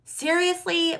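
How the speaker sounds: a high, loud, fed-up seriously; exasperated seriously. Retold or imitated. A viewer hitting the last roadblock, exasperated. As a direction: throw up your hands at it. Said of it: "where you're like".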